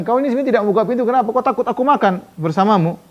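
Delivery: mocking taunt. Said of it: "Jadi dia mengatakan".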